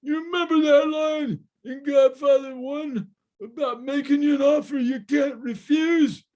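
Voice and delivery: loud, high-pitched mock mafioso threat; mafioso's voice. Mafioso answering the producer about casting, high strained menace. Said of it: "he talks like this".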